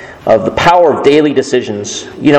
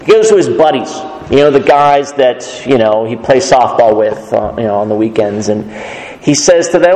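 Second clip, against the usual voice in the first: usual speaking voice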